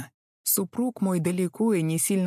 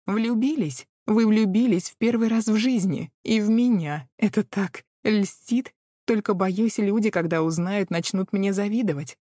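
high, loud, sugary coquette; sugary flirt; 4 semitones higher, 4 dB louder than usual. Acted. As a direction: gush like a flattered starlet. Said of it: "произнесла это сладко".

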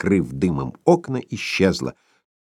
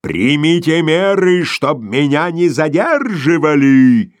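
louder, higher and slow, in a voice unlike the narrator's own; shout; 5 semitones higher, 5 dB louder, slower than usual. A narrator's reading. Boss shouts an order to the doorman. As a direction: bark the order over the shoulder. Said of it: "крикнул".

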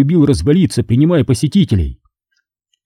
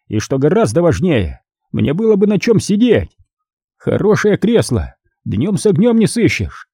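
high-pitched cardinal voice, mocking Mister Raimi; composed cardinal; 5 semitones higher than usual. Cardinal musing to his captive over the smashed window, high-voiced. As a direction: play the cardinal pitched well above your own voice.

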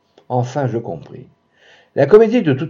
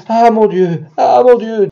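laughing cry; 10 semitones higher, 7 dB louder than usual. A narrator's reading. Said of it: "ces rires ... coupés du".

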